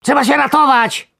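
very high, loud worm yell. Acted. Worm yells at sleeping friends to wake up. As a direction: shriek them awake. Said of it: "wrzasnął".

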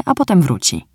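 plain reading voice

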